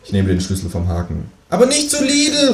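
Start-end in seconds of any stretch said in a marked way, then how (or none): 1.5-2.5 very high kangaroo call; calling after someone. A narrator's reading. Kangaroo calls after neighbour going shopping, high. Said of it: "ruft mir das Känguru hinterher".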